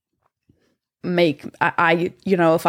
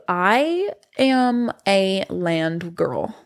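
slow emphatic declaration; much slower than usual. Real, not acted. Drawn-out emphatic self-label.

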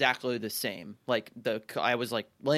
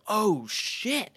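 high-pitched retold alarm; mock fright, pitched up; scared; 9 semitones higher than usual. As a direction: act out a startled reaction. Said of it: "was so scared".

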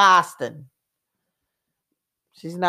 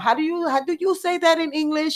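lightly voiced pretend Spanish speaker, pitched up; mock fake accent; 9 semitones higher than usual. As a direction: play dumb about English in a put-on voice. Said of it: "on talk shows talking about".

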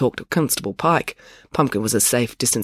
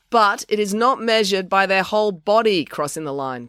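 raised pitch, precise lecturing; pedantic; 7 semitones higher than usual. A narrator's reading. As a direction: explain it like a stickler for rules. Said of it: "with his characteristic pedantry".

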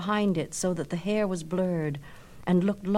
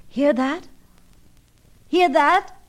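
much higher and louder, delighted; overjoyed; 9 semitones higher, 7 dB louder, slower than usual. Acted. Narcissus thrilled by the echo, high and loud. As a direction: burst out with joy. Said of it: "cried Narcissus, overjoyed".

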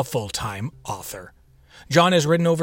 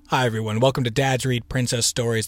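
ordinary talk

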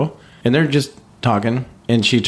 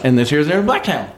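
imitated Southern lady, held high; sweet Southern lady; 7 semitones higher than usual. Sweet Southern lady remarking from the back seat, imitated high voice. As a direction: chatter on in a high matter-of-fact Southern voice. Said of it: "sweet Southern lady".

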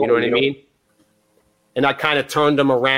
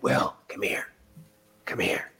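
hush-voiced beckon from the couch; a stoned friend whispering for help; whispered call; 10 dB quieter than usual. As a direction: whisper it, breathy and secret. Drummer whispering from cabana.

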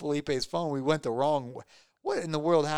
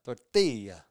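lower-pitched try at Spanish Tortilla; imitated Spanish accent; 4 semitones lower, slower than usual. Shown as a demonstration. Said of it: "you guys roll those L's".

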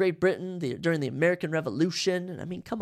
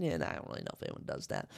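much lower and softer throwaway aside, fast; muttered aside; 10 dB quieter, faster than usual. Real, not acted. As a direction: mumble it off to the side. Muttered aside after joke.

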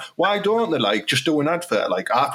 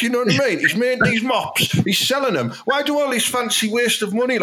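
normal talking voice

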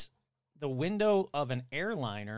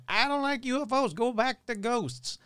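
mock complaining fan; 9 semitones higher, 3 dB louder than usual. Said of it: "everybody's like".

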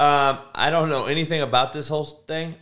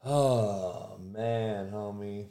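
stunned murmur; 8 semitones lower, 12 dB quieter, much slower than usual. Real, not acted. Stunned, dropping his voice.

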